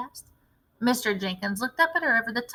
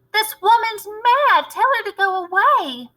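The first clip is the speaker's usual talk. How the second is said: high, loud, outraged dismissal; high indignant bluster; 10 semitones higher, 4 dB louder than usual. A narrator's reading. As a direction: wave her off in high outrage. Mr Jenkins rejecting grandmother.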